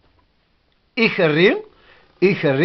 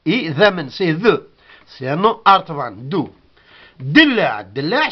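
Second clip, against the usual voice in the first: ordinary talk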